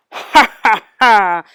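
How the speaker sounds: loud mocking villain laugh; ha ha ha like a wicked wizard; horrible laugh. A narrator's reading. Wizard mocks the fairy godmother, loud evil laugh. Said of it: "laughed his horrible laugh".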